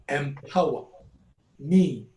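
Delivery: ordinary talk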